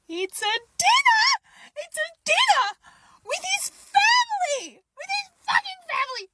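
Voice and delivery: high, loud, disbelieving outburst; shocked realization. Retold or imitated. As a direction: shout it as it dawns on you. Sudden realization, dinner with family.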